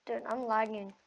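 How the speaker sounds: normal talking voice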